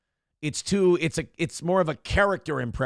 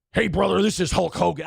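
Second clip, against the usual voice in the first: wrestling promo voice, higher; Hulk Hogan; 4 semitones higher than usual. Acted. Comedian tries a prank-call opening, promo-style wrestler impression. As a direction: open it like a wrestler cutting a promo. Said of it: "I can only do, like, promo Hulk Hogan".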